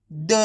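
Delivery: द sounded alone with a neutral release; half-pronounced letter. Shown as a demonstration. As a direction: give द without a full "aa". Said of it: "last वाले अक्षर का उच्चारण आधा किया जाता है". (द का आधा उच्चारण)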